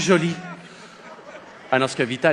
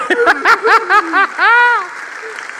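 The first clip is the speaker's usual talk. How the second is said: loud high laugh; 5 dB louder than usual. Real, not acted. Comedian laughs hard at joke.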